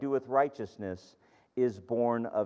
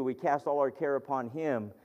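ordinary talk, nothing marked

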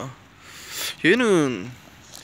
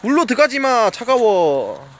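high loud shout to stop the dog; calling out; 8 semitones higher, 7 dB louder, slower than usual. Real, not acted. Owner shouting at his dog not to enter cold water, loud warning call. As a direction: warn the dog off sharply.

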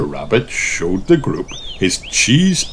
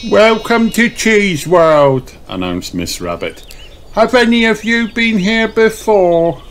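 Miss Rabbit voice; 12 semitones higher than usual. Acted. Miss Rabbit welcomes the party, cheerful guide voice. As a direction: greet them like a bubbly guide.

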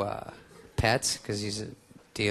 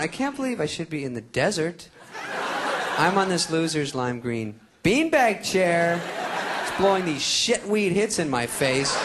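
high whiny friend voice; 8 semitones higher than usual. Retold or imitated. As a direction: whine it out like a stoned buddy. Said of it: "Tarantula's going".

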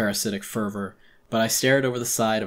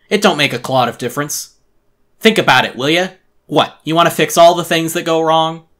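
loud, raised-pitch gruff-tempered lecturing; scolding angry resident; 6 semitones higher, 12 dB louder than usual. Acted. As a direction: lay into them with emphatic, loud reproach.

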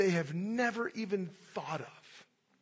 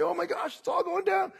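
mock panic, higher and louder; panicking; 10 semitones higher, 6 dB louder than usual. Preacher mimicking Israelites in hardship, hammed-up panic. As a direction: cry out that it's all collapsing. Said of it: "They say".

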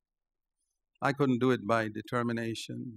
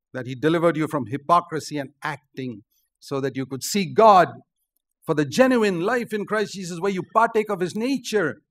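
loud, high climax of the sermon; preaching at full force; 4 semitones higher, 7 dB louder than usual. Acted. Preacher proclaiming the genuine life in Christ to the church, at full force. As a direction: build to a ringing cry. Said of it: "preaching climax".

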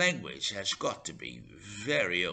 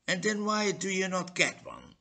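irritated; 9 semitones higher, 5 dB louder than usual. Acted. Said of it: "highly irritated".

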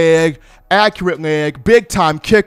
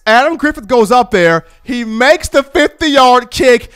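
usual speaking voice

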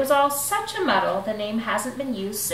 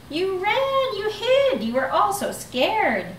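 teasing; 10 semitones higher than usual. Acted. Bonehead teasing friends.

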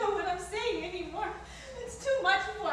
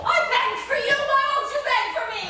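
higher and louder, hurt and furious; angry reproach; 7 semitones higher, 6 dB louder than usual. Acted. Wife reproaches her husband, higher and louder in anger. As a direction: throw it back at him.

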